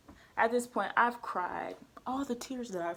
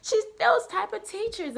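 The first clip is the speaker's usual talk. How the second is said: ordinary delivery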